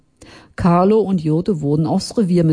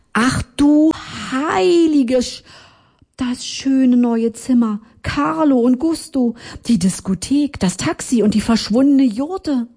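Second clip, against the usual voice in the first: aghast, higher-pitched recollection; dismayed realisation; 7 semitones higher than usual. Acted. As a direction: exclaim it high, item after item flooding back. Narrator suddenly remembers, dismayed.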